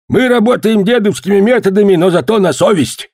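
put-on high-pitched old man's character voice. A narrator's reading. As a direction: speak up high as a skinny old man. Skinny old man's voice, story figure.

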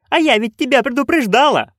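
high-pitched scolding cry; narrated yelling. A narrator's reading. Nanny yelling at her friend on the broom. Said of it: "проорала она".

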